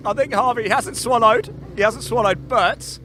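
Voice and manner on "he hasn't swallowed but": ordinary talk, nothing marked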